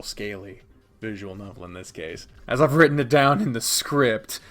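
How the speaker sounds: ordinary talk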